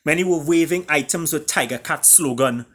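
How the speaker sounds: plain reading voice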